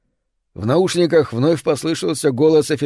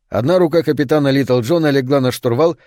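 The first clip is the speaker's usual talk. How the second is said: ordinary delivery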